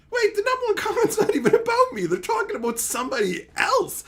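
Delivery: high surprised realization. Retold or imitated. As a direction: squeak it out in disbelief. Realizing thread is about someone else.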